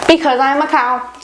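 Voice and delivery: ordinary delivery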